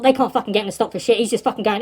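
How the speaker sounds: plain reading voice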